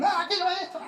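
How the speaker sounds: put-on high squealing child demanding; a spoiled child squealing for a treat; mock child shout. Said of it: "esos chicos gritan".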